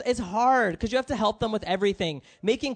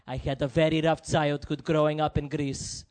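imitated emotional dad; 6 semitones lower than usual. Greek dad opening up to his son at the pool, imitated emotion. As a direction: impersonate a tearful immigrant father, drawing out the end. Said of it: "he starts crying, and he goes".

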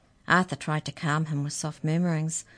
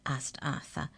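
plain reading voice

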